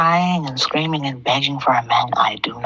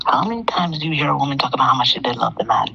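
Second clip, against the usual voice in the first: usual speaking voice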